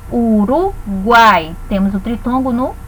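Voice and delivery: "Uruguai" very slow, with "guai" as one unbroken beat; syllable by syllable. Shown as a demonstration. Teacher showing that triphthongs stay together, slow syllable-by-syllable delivery. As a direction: stretch "Uruguai" into syllables, keeping the triphthong whole. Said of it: "ditongos e tritongos permanecem juntos".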